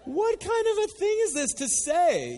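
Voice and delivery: loud, very high-pitched bafflement; incredulous outburst. Acted. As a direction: burst out in scandalised disbelief. Scandalised reaction to Jesus' words.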